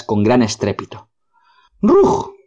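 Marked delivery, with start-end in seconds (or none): 1.8-2.3 very high, sharp yelping "Rug!"; dog's bark. Acted. Dog Boris barks at the approaching Rugs, high yelp. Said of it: "volvió a gritar Boris".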